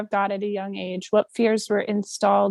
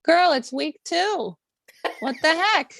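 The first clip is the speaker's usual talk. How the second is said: put-on higher, teasing girlfriend tone; mock-scolding her friend over week two, higher and playful; teasing sass; 6 semitones higher than usual. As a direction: tease her like a sassy friend who can't believe it. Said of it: "I go".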